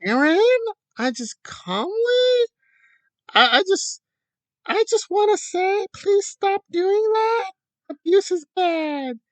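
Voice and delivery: mocking how he should have asked nicely; mock meek plea. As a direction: parody a dainty over-polite request.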